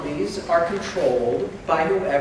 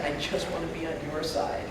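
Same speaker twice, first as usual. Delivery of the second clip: much softer, meek plea; meek whisper; 11 dB quieter than usual. Retold or imitated. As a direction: whisper it submissively. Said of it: "are just like".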